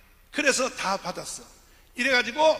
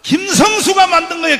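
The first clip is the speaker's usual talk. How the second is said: preaching at full force; 7 semitones higher, 14 dB louder than usual. Acted. Preacher rousing the rally crowd to rise up, full-force shout.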